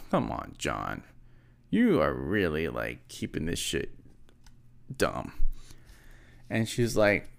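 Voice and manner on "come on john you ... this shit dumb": much lower, softer, slow disgusted grumble; low disgusted mutter. Retold or imitated. Telling [name] off, low slow grumble.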